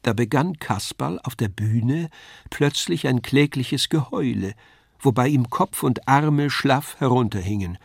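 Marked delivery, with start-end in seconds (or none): none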